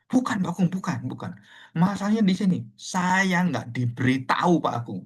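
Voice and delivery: high insistent protest, drawing out the grievance; retold angry complaint. Aggrieved elder explains to pastor he was not told, acted angry. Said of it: "Dia marah-marah".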